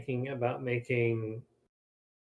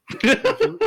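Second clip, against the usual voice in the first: excited; 9 dB louder than usual. Real, not acted. Excited jump in explaining.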